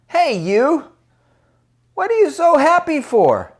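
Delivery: high, loud, put-on whiny old woman; whining. Older woman calls over to the happy boy, whining. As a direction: call out in a high, whiny challenge. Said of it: "they're used to whining".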